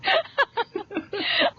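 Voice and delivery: real high-pitched laugh. Real, not acted. Hostess laughing at the guest's old computer with a real high laugh. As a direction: burst out laughing.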